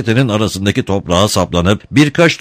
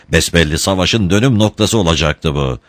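plain reading voice

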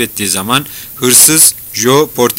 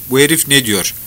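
usual speaking voice

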